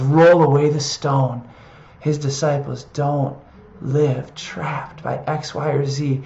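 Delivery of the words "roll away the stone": gentle repeat. Shown as a demonstration. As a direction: say it again as a tender promise. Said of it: "he loves to speak that".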